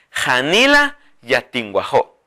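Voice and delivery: Janila yatinguajó drawn out; slow dictation. Shown as a demonstration. Teacher models the phrase slowly for the viewers. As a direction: say it slowly and clearly. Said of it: "se dice".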